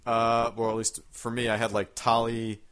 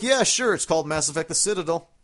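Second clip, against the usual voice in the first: cheery offer; 6 semitones higher, 4 dB louder than usual. Retold or imitated. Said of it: "They're like".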